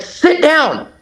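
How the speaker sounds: high urgent order; yelling at him. Retold or imitated. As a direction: shout it high to make him duck. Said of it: "I'm yelling at him".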